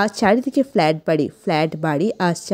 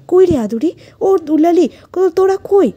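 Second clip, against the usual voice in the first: high, loud parents' call for missing daughters; 9 semitones higher, 7 dB louder than usual. Acted. Parents searching for their daughters as villages burn, calling out. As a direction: call out urgently for the children by their pet names. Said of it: "মা বাবা ডাকছে আমাদের ডাক নামে".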